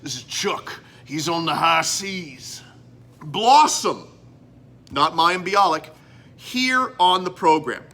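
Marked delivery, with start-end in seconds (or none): 0.0-4.1 sailor voice. Acted. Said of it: "I turn into my sailor voice".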